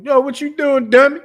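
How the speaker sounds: high, loud Baltimore street greeting; Baltimore accent. Retold or imitated. Host imitating a Baltimore local hailing a friend, high and loud. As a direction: call out to a pal in broad Baltimore. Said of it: "and they have the accent".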